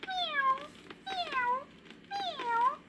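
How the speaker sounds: high, soft sighing mews; kitten mews. Acted. Scolded kittens sighing, soft high mews. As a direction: mew sadly like scolded kittens.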